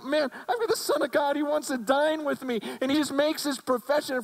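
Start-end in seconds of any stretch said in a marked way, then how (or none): 0.0-2.6 high, fast, thrilled voice; excited Zacchaeus. Retold or imitated. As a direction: burst out with joy. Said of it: "gets in his house and goes".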